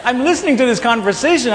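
laughing through the words, high and loud; can't hold back a laugh; breaking into laughter mid-sentence. Real, not acted. Laughing while telling.

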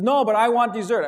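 mock toddler demanding dessert; whiny child. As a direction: whine it like a stubborn little boy. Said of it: "He says".

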